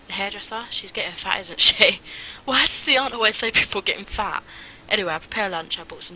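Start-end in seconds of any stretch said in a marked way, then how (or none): 1.4-4.4 laughing. Real, not acted.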